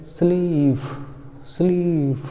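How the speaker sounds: teacher demo of long ee in स्लीप. Shown as a demonstration. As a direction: stretch the ee in स्लीप. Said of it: "क्या पढ़ेंगे?".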